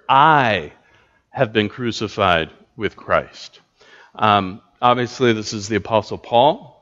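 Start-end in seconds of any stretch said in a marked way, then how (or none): 0.1-3.6 "I" lifted and held apart, rest falls away; stress on I. Shown as a demonstration. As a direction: lean hard on "I". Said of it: "emphasize that, that first word".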